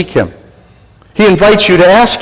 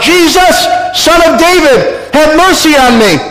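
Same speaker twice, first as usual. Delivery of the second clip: crying out; 11 semitones higher than usual. Acted. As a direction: call out to Jesus from the roadside, begging. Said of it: "began to shout out".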